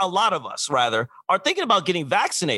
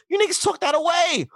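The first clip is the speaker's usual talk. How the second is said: ordinary talk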